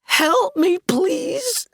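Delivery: high, rising pitiful cry; mock-tragic whimper; desperate plea. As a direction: beg for rescue. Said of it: "reached out to Sooty and said".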